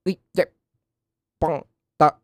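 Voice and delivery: choppy broken phone line; breaking up call. Acted. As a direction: drop syllables like a failing connection. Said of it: "putus-putus suaranya".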